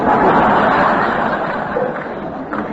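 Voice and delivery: a short, breathy laugh out of nowhere; rueful laugh. Real, not acted. Laughing at his father's old verdict, ruefully. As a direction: laugh it off, half amused.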